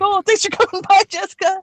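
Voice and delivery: imitating dorky hosts, high and sweet; a hint of a goofy host; mocking nerds. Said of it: "And they're like".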